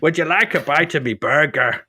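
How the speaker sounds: lilting put-on Irish voice; hammed-up Irish lilt; mock Irish accent. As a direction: offer it like an Irish burger seller. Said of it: "a lovely lilting Irish voice".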